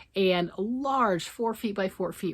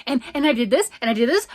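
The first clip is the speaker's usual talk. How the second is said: auctioneer voice; 5 semitones higher, 9 dB louder, much faster than usual. Retold or imitated. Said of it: "I was talking like this".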